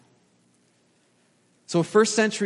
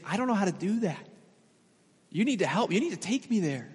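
put-on innocent little girl; 3 semitones higher, 4 dB quieter, faster than usual. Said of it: "this little girl so innocently is saying".

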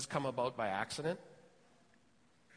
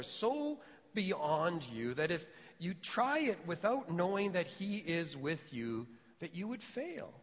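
ordinary talk

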